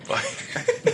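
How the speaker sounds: breathy high laugh. Real, not acted. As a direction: let out a soft high giggle. Laughing about the accent.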